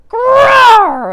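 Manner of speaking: bear roar. Acted. Old Bear roaring as she tugs his fur. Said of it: "Old Bear roared".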